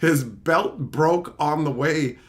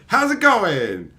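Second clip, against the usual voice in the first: slow, high parroting greeting; mocking; 4 semitones higher, 4 dB louder, slower than usual. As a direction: drag it out, parroting someone mockingly. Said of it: "he was mocking me".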